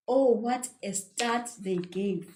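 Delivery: ordinary talk, nothing marked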